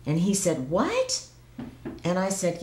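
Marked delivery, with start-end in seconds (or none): 0.7-1.3 much higher, startled what; husband's surprised what. Retold or imitated. Retelling her husband's shock at moving, high startled what. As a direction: toss it up in disbelief. Husband hears move to Mexico.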